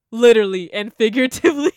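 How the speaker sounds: loud laughing payoff; laughing punchline. Real, not acted. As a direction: land the joke with a laugh.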